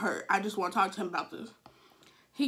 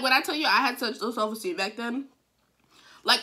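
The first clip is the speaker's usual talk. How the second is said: ordinary delivery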